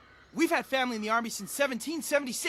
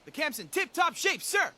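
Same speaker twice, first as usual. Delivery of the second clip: eager soldier report; 7 semitones higher than usual. Acted.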